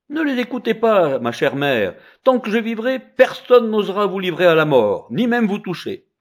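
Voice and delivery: high, loud protective vow; confident child. Acted. Son vowing to protect his mother, high and bold. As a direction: promise her with bold certainty.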